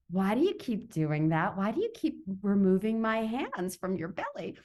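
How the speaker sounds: ordinary delivery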